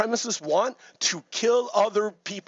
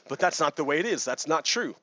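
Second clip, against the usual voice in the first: ordinary talk, nothing marked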